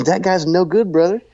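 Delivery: Joe Dirt voice. Retold or imitated. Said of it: "he sounded just like Joe Dirt".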